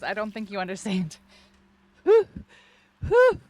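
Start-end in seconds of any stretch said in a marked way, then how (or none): 2.0-3.4 high, loud whooped whew; overwhelmed whew. Real, not acted. Sweating streamer reels from gifts, high overwhelmed whew. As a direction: let out a sharp high whew of disbelief.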